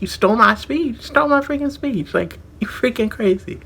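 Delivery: high, strained yell of outrage; furious outburst. Retold or imitated. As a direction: imitate losing it at the thief. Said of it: "go off on this freaking person".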